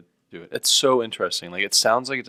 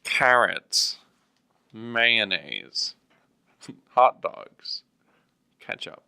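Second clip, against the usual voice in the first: listing groceries slowly, a bit louder, mock old man; old man's shopping list; 2 semitones higher, 6 dB louder, much slower than usual. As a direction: read the list like a confused grandpa. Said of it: "and be like".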